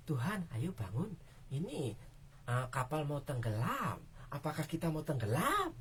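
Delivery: mild, quiet version of the plea; calm polite request. Shown as a demonstration. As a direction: say it politely as if nothing is wrong. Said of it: "Bukan seperti itu".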